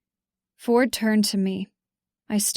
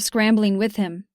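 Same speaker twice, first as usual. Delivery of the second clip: plain reading voice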